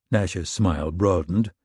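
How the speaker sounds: plain reading voice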